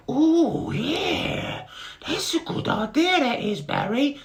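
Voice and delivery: very high, loud squeaky beetle voice; high squeaky Bart. Acted. Dim little brother greeting Barry, high squeaky voice. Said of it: "said Bart".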